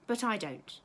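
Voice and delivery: plain reading voice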